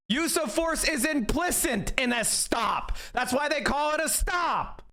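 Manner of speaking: exasperated, voice up near a shout; losing it. Real, not acted. Yelling at the justices, high exasperated fury. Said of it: "I'm losing it".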